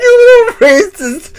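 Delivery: very high, squealed through laughter; helpless laughter. Real, not acted. Teasing a friend over a misheard word, helpless laughter. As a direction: barely get it out while cracking up. Accusing friend while cracking up.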